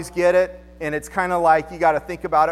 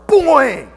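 loud high springy "boing"; cartoon boing; 7 semitones higher, 10 dB louder than usual. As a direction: pop it like a spring. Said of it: "stiff as a board".

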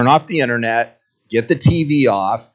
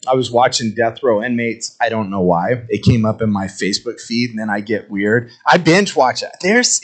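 ordinary talk

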